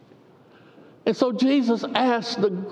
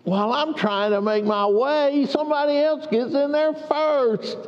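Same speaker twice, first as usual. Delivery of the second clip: whiner; 7 semitones higher than usual. Acted. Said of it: "That's how it really sounded".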